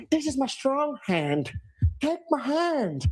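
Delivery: high, slow, creepy offer of the strong hand; creepy mock manager voice. Fan mocking the manager's strong hand, creepy high voice. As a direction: lure them in with a creepy high voice. Same mockery again.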